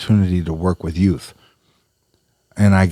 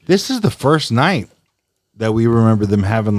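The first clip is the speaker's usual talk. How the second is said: ordinary delivery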